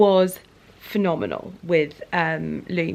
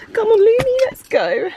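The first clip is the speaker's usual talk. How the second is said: cheery urging; 13 semitones higher, 7 dB louder than usual. Real, not acted. Urging cat to leave.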